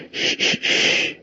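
soft, slow whispered shh; whispered shushing. A narrator's reading. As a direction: hush a frightened child gently. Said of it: "he whispered".

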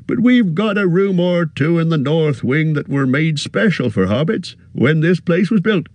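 a fussy, friendly innkeeper bending down; cheery innkeeper. A narrator's reading. Innkeeper greets the hobbit, high and bustling.

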